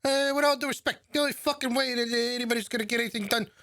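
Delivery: hammed-up New York pitch; New York accent. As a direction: rattle it off like a pushy New Yorker. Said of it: "doing his typical New York".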